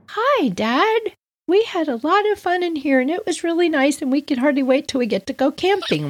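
put-on very high sweet kid voice; innocent kids. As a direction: play innocent, all sugar. Kids acting innocent to dad.

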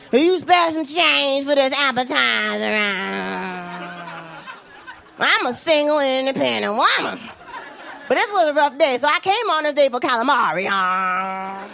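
begging panhandler. Retold or imitated. To her date, a mock beggar asking for spare change. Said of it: "all he hears me saying is".

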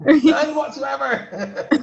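drawn-out, slow, through a high laugh. Real, not acted. Guest laughing at the host's joke, slow and high. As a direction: laugh your way through it slowly.

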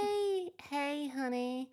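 slow, high American "hey, honey"; American accent. Shown as a demonstration. Mum retries the accent at daughter's urging, slow high American drawl. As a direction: draw it out like a sweet American. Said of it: "a bit more like, "Hey".

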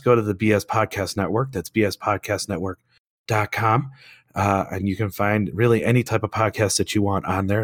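rough, strained lost voice; hoarse voice. Real, not acted. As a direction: croak on through hoarseness.

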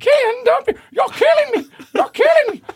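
high, loud shrieking ghost voice; dying ghost shrieking in a card; screaming ghost. Acted. Ghost card being burned.

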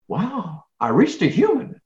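high-pitched mock amazement; amazed caller. Customer finally getting a person on the phone, amazed. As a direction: act out wonder, pitch leaping up.

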